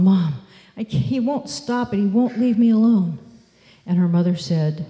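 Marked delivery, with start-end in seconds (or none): 0.0-3.2 put-on mild higher-pitched plaintive kid to mom; lightly voiced bullied girl; upset child complaining. Bullied girl tells her mom, upset complaint.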